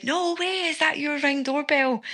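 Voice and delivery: high, astonished mock kid voice; imitating a stunned boy; amazed. Boy amazed to learn it was her doorbell, surprised. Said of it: "his face just was like".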